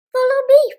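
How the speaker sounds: high, loud beckoning call; cried. Acted. Foxy Loxy luring the birds, high loud call. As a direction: call them along eagerly. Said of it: "cried Foxy Loxy".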